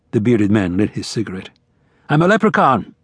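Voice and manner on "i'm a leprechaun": higher, grinning announcement; playful lift in pitch, grinning; said with a grin. A narrator's reading. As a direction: announce it through a cheeky grin. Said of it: "he said, with a grin".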